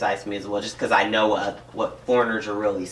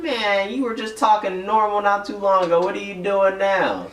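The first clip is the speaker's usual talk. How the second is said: mock call-out; 9 semitones higher than usual. Listener catching someone changing how they talk, mock call-out. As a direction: call them out in a higher put-on voice. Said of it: "they'll call me out on it".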